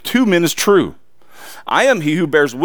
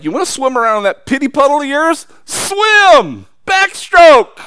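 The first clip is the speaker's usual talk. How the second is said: sarcastic, building to a loud, high shout on Swim and Backstroke; a teasing God voice rising to a yell; mock God scolding; 7 semitones higher, 4 dB louder than usual. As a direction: taunt them into the pity puddle. God mocking self-pity in sermon.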